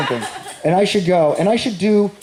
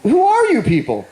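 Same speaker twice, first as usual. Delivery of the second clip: high incredulous; 8 semitones higher than usual. Retold or imitated. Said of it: "I'd be like".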